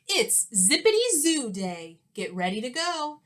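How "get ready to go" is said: usual speaking voice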